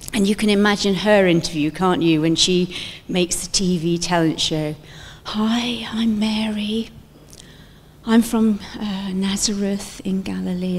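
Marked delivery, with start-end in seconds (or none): 5.2-10.8 soft, breathy-less whispered nerves; shy whisper. Pretend. Mary whispering shyly at her talent show interview. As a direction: whisper it like a nervous contestant.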